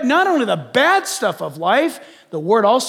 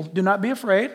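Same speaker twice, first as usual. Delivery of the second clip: preaching scripture; 5 semitones higher, 3 dB louder than usual. Acted. Moses calls out to the frightened people, preaching at full force. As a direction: proclaim it like Moses rallying the people. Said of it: "and Moses said to the people".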